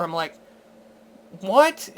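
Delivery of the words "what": short high rising what; a baffled, higher yelp of a question; mock stunned what; incredulous what. Retelling his reaction again.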